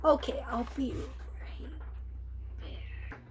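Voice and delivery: real whispered aside; hushed, breathy aside. Real, not acted. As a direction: drop to a whisper off to the side. Unvoiced aside off the story.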